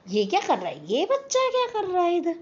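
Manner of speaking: puzzled scolding. Retold or imitated. Street vendors staring puzzled at the boy hiding behind the tree. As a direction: eye him up and wonder aloud what this kid is doing.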